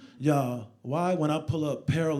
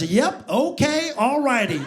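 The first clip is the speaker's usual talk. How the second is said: put-on high-pitched clueless kid, rising endings; helpless, chirpy fast-food worker impression; Mike; 8 semitones higher, 4 dB louder, slower than usual. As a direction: play the kid caught in the middle.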